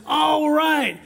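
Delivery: high, loud, laughing cheer; excited cheer. Acted. Texan in frozen hell cheering the Rangers' win, a high loud laughing whoop. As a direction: whoop it like your team just won.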